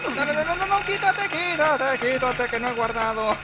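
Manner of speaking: high, loud frantic string of no's; panicked pleading. Real, not acted. Gamer frantically begging monsters to back off. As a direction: beg them off in a rising panic. Monsters attack, hasn't saved.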